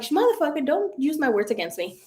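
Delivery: high-pitched comeback, easing down; mock indignant retort. Retelling her comeback to her brother, mock indignant. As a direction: protest in mild mock outrage.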